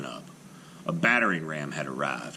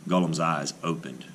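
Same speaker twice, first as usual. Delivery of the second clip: plain reading voice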